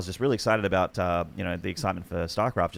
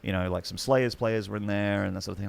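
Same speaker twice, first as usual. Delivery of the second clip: ordinary talk, nothing marked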